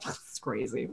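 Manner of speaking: very low, barely audible murmur; hushed aside. Acted. Acting him looking over at her amid the fuss, hushed aside. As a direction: drop to a tiny acted undertone.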